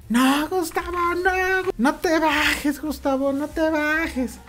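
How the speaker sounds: high, drawn-out mock panicked plea; pleading mother-in-law. Mother-in-law begging him not to get out, mock pleading. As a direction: plead in a high, stretched imitation voice.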